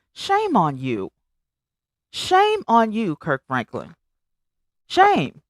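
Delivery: real scolding. Real, not acted. Host scolds [name] over bad parenting, reproachful.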